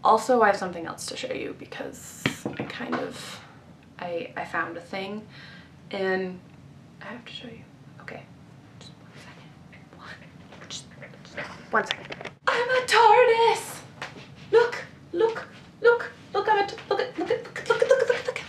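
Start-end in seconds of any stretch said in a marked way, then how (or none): 0.6-12.3 breathy hushed excitement; conspiratorial whisper. Real, not acted. About to show viewers her find, whispering. 12.5-18.5 much higher, gleeful squeals; squealing excitement. Real, not acted. Showing off her tardis item to viewers, squealing.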